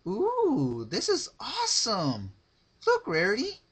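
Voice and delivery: very high, loud, delighted pony squeal; gleeful Pinkie squeal. Acted. Pinkie showing Rarity the skin, squealing with glee. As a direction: squeal it like a kid showing off a toy.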